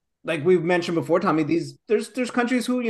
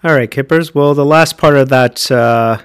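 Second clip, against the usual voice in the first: louder sign-off voice; podcast outro; 3 semitones lower, 10 dB louder than usual. Acted. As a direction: wrap up the show to listeners.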